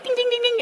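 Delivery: high sing-song imitating a ringing bell; playful high bell imitation; mock bell ringing. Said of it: "It was like a flipping cowbell".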